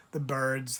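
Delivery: usual speaking voice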